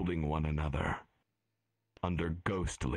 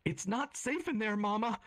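put-on child's shriek. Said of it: "the child shrieks".

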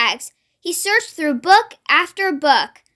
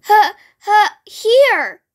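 high, halting dragon puffs; louder, breath-by-breath storybook dragon; dragon's hiss; 4 semitones higher, 4 dB louder, slower than usual. A narrator's reading. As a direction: huff the word out in bursts like a struggling dragon. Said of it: "hissed the dragon".

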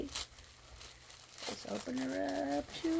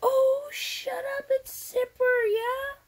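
delighted surprise; 13 semitones higher, 7 dB louder, slower than usual. Real, not acted.

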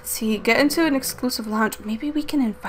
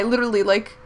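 ordinary talk, nothing marked

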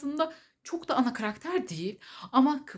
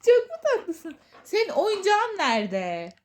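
talking to a dog; 7 semitones higher, 5 dB louder, slower than usual. Real, not acted. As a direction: coo at the puppy. Baby talk to her pet. (köpeğe bebek sesiyle)

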